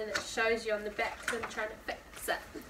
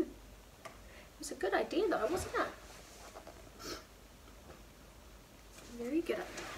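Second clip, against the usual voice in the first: whispered aside; 4 semitones higher, 10 dB quieter than usual. Real, not acted. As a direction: lean in and whisper it. Reader aside to children.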